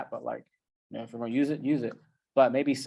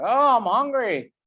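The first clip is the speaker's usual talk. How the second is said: whiny high moan, slow and louder; mock hungry whine, pitched way up; hungry brain; 9 semitones higher, 5 dB louder, slower than usual. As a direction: complain like a starving brain. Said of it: "plucks you in the back of the head, like".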